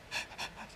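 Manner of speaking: sharp frightened intake of breath; quick panicked gasp; fearful gasp. Shown as a demonstration. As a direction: gasp as if scared. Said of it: "we go like".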